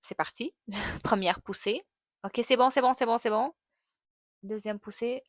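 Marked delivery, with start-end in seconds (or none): 2.2-3.5 reliving the push. Retold or imitated.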